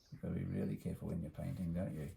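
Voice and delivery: ordinary delivery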